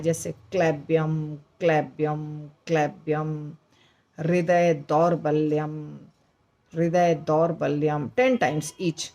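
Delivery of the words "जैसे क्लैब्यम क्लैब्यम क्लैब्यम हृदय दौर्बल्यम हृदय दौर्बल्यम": क्लैब्यम and हृदय दौर्बल्यम repeated slowly, lower; repetition drill. Shown as a demonstration. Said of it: "उसको आपको दस बार बोलना है". (शब्दों को बार-बार दोहराना)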